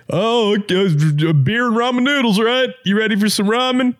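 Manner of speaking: high, excited mock of everyone; mocking hype voice. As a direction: hype up ramen like a college bro. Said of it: "everybody was just like".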